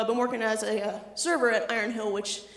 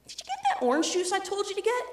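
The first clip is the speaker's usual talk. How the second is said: put-on child voice; 10 semitones higher than usual. Little kid demanding juice.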